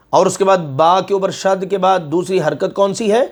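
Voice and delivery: usual speaking voice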